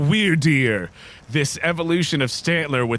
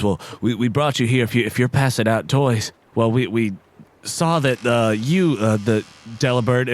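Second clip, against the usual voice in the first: hesitant, lower deer voice; lightly voiced shy deer; 3 semitones lower than usual. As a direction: explain nervously to the visitors. GM voices Randy the Sawsbuck.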